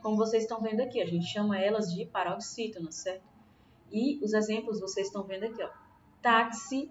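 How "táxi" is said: TÁ of "Táxi" punched; stress on penultimate syllable. Shown as a demonstration. Teacher showing pupils the strong syllable, stress on TÁ in "Táxi". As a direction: lean on the first syllable of "Táxi". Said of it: "qual é a sílaba mais forte?".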